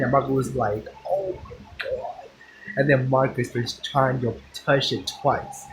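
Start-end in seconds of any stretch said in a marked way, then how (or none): 1.1-2.3 imitated breathy, near-voiceless gasp of shock; hushed shock. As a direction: whisper it softly, as Marcus reacting. Retelling Marcus's shocked reaction.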